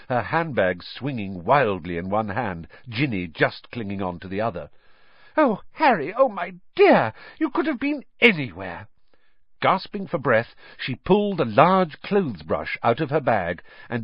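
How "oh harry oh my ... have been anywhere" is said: high, relieved motherly fuss; anxious mum flapping over him. Acted.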